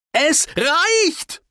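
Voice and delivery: high, drawn-out furious shout; fed-up bellow; roaring. A narrator's reading. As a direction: roar it at the top of your range. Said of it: "brüllte ich".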